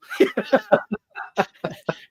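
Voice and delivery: a high burst of laughter. Real, not acted. Laughing at the cramped seat.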